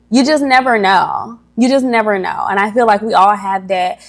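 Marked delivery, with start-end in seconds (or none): none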